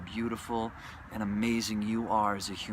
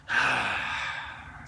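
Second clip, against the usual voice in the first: breathy exhale; low sigh; 12 semitones lower than usual. Real, not acted. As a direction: let out a slow sigh. Sigh after quote.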